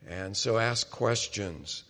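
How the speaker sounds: normal talking voice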